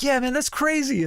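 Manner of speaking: bright eager stranger recognizing him; excited fan. Retold or imitated. A young fan at a restaurant calls out to him, eagerly. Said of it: "he was like".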